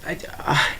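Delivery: stammering whisper, lost for words; speechless whisper. Real, not acted. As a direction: breathe it out as if struck dumb. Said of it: "I'm speechless now".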